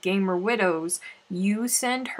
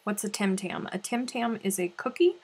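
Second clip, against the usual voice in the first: ordinary talk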